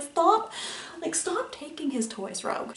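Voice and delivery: sharp, high-pitched stop; a pleading, exasperated stop. Retold or imitated. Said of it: "I'm just like".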